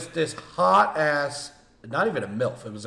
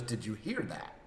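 quiet retold question; 6 semitones lower, 14 dB quieter than usual. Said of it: "she goes".

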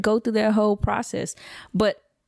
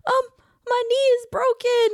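put-on high little-kid voice; imitation of a pitiful child; whining son. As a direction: play up the hurt like a small boy. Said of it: "He's like".

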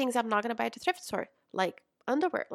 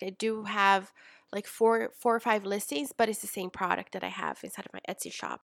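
ordinary talk

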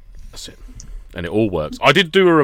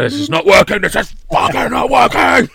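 hammed-up frustrated yell, climbing high; mock rage; 11 semitones higher, 4 dB louder than usual. Acts out losing patience at work.

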